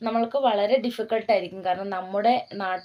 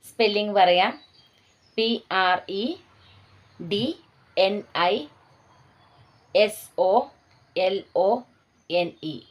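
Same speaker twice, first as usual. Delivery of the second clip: slow, spaced-out teacher dictation with long pauses. Shown as a demonstration. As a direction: spell each word out letter by letter, pausing so students can write. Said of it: "ഈ പ്രാവശ്യം ഞാൻ നിർത്തി നിർത്തി തന്നെയാണ് പറയുന്നത്". (നിർത്തി നിർത്തി പറയുന്ന ഡിക്റ്റേഷൻ)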